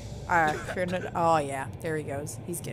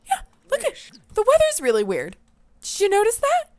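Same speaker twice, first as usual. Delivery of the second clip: much higher chattering voice; squeaky gossip voice; 12 semitones higher, 6 dB louder than usual. Pretend. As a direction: chatter it up high like a busybody. Sim gossiping about strange weather.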